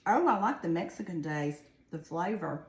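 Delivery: talking with mouth full; eating while talking; chewing noisily. Real, not acted. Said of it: "I'm sorry I'm eating instead of talking".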